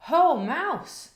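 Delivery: loud high cheery call; hearty hare greeting. A narrator's reading. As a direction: greet an old friend loudly. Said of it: "says Hare".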